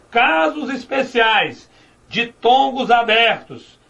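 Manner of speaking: "casos especiais" said slowly, leaning on "casos"; slow emphasis. Shown as a demonstration. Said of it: "Olha só".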